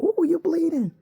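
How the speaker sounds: put-on high, loud child's shout; kid voice. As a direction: squeal it like a startled kid. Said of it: "One of the kids like".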